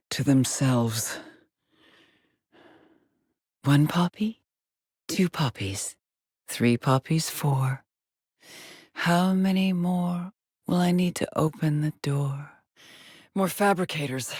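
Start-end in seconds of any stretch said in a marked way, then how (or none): 3.6-12.5 sing-song counting. Acted. Alone counting poppies to open a door, sing-song. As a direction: count off in an eerie little rhyme.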